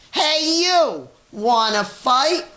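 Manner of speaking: high, loud challenge from a tiny bug; grouchy ladybug. A narrator's reading. Ladybug squaring up to the rhinoceros, high and loud. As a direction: squeak it out like a picking-a-fight bug. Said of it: "the grouchy ladybug said".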